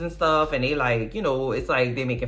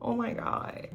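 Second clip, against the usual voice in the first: small, softer voice, higher; mock anxious whisper-soft plea; timid and soft; 8 semitones higher, 9 dB quieter than usual. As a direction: ask it meekly, almost under your breath. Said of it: "Miranda's like".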